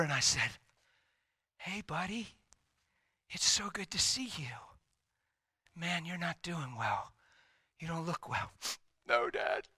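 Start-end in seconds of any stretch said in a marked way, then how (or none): 1.6-8.5 soft, gentle retold greeting; tender father. Father greets sickly son at the door, retold tenderly. 9.1-9.7 high, small imitated son's voice; tearful son. Sickly son answers his dad, imitated tearful voice.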